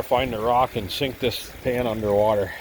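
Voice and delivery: normal talking voice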